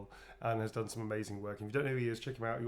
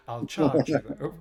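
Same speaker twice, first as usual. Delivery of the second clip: mock seductive; 10 dB louder, slower than usual. Flirty joke after darling.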